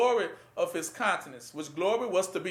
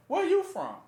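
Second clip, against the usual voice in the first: higher, pressing Where you from; demanding official; 10 semitones higher than usual. Acted. Preacher voicing a government questioning people, higher and insistent.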